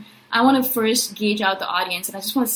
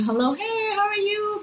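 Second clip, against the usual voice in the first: cheery greeting; 12 semitones higher than usual. Retold or imitated. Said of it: "came in and out and say".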